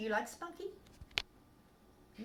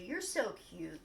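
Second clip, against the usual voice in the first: ordinary talk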